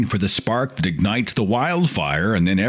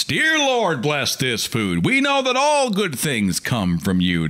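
hammed-up pious grace, much higher; mock grace prayer; 7 semitones higher than usual. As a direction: overdo a devout mealtime prayer. Mimicking a family grace prayer.

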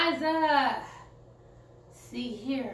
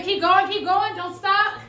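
urging on; 5 semitones higher, 5 dB louder than usual. Real, not acted. Coaching through workout count.

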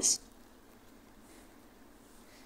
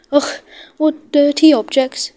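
a loud, sneering ugh of disgust; rude disgust; 5 semitones higher, 7 dB louder than usual. A narrator's reading. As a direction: sneer at the shabby engines. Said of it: "James was very rude".

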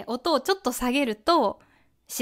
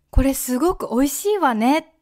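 美味しいわね with わね rising; 2 semitones higher, 4 dB louder than usual. Shown as a demonstration. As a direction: ladylike rising わね at the end. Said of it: "すごく女の人に聞こえます".

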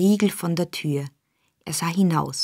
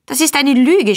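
high, loud cry of protest; outraged shout; 7 semitones higher, 5 dB louder than usual. A narrator's reading. Boy shouting back at the mistress, high and loud. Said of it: "schrie der Junge".

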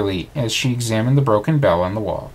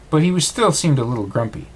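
plain reading voice

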